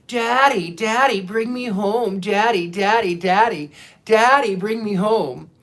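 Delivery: little nasally puppet voice, loud and insistent; pleading like a pestering child, nasal and pushy; My Friend the Devil. Retold or imitated. Devil puppet begging Daddy in a dream, nasally and insistent. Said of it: "that same little nasally voice that he uses".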